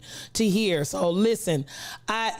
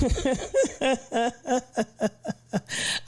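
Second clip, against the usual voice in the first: long run of wheezy chuckles; laugh; 4 semitones higher than usual. Real, not acted. Streamer laughing at Rebel saying it's too early, laughing. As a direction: keep chuckling, amused.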